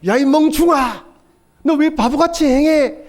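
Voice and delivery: high, loud, slow scolding yell; angry scolding. Retold or imitated. Parent yelling at his son in anger, loud and high. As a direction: bark at a child in a burst of temper. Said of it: "그때 화가 나서 한마디 한 거".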